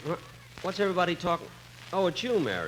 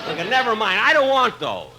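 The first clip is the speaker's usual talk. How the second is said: louder, higher, exasperated protest; annoyed refusal; 5 semitones higher, 6 dB louder than usual. Acted. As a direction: push the clerk off, fed up. Refusing the salesman's suits.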